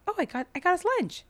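high, fast, cheery mock husband voice; husband proudly announcing the sandwiches, high and quick; cheerful husband. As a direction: play him proudly clueless.

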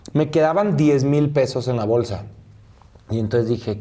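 normal talking voice

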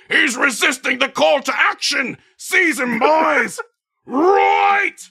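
shouted order. Acted. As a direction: yell at the boys to seize him. Gnome orders seizing the boy.

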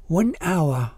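put-on weary accented English, hesitant; weak foreign accent. A narrator's reading. Old woman at cold bus stop answers pastor, weak accented English. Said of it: "voice was weak as she slowly spoke in accented English".

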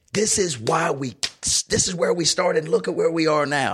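rally the team like their proud coach; uplifting youth-coach speech, imitated; encouraging coach. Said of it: "Mister Dunlap's encouraging our whole team".

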